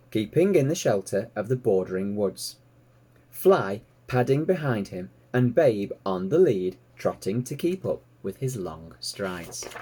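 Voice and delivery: plain reading voice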